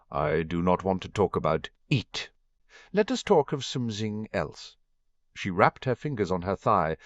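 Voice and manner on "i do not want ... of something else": put-on French accent. Narrator voices Fleur.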